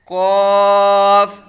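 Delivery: loud, very long قاف with a heavy rounded vowel; full, thick Qaaf. Shown as a demonstration. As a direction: thicken قاف and sustain it level. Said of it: "یعنی موٹا کر کے پڑھیں گے".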